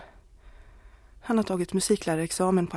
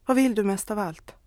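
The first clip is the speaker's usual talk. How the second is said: ordinary delivery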